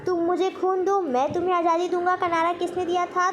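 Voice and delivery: normal talking voice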